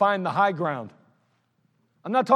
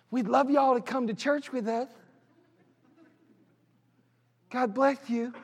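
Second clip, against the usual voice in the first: higher, sugary churchy voice; fake pious invite; 4 semitones higher than usual. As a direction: sweet-talk the neighbors, overdone. Mocking a two-faced neighborly invite.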